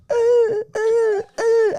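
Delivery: high strangled choking gasps; dog being given CPR on the beach, choking gasps. Retold or imitated. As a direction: cough and choke like a dog with a fish stuck. Dog choking, voiced in story.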